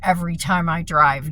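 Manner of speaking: anguished cry of someone at the end of her rope; desperate outburst. Retold or imitated. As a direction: pour out despair to her dad. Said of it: "I said".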